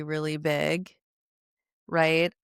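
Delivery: ordinary delivery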